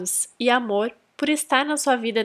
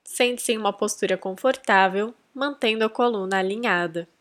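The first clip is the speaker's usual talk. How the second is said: guided meditation; 7 dB quieter than usual. Acted. Host leading listeners to attract a soul mate, guided meditation. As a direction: guide them gently inward, unhurried.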